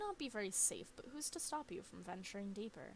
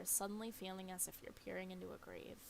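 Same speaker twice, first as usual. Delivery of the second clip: normal talking voice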